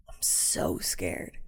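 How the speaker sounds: soft, slow, very low whispered fear; scared whisper. Retold or imitated. Small son telling his dad he's scared, whispered. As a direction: breathe it out like a frightened child.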